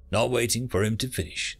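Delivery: ordinary delivery, nothing marked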